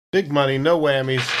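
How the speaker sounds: usual speaking voice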